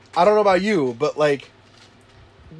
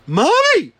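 put-on much higher kid's shout of Mommy; child yelling; 13 semitones higher, 5 dB louder than usual. Child shouting for mommy, high. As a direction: yell for mommy like a toddler. Kid voiced.

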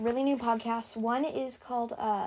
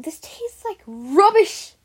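slow, loud mock British accent; hammed-up fake British accent, drawn out; a put-on British accent, overdone; phony British accent; 8 semitones higher, 11 dB louder, slower than usual. A narrator's reading. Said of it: "in her phony British accent".

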